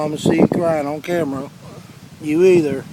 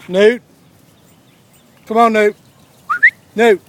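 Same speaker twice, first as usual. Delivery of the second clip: loud high call of a puppy's name; raised name call; 8 semitones higher, 12 dB louder than usual. Real, not acted. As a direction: call the stray pup back across the yard. Calling a wandering puppy.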